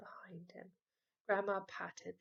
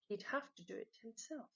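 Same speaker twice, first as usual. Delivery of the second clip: ordinary delivery, nothing marked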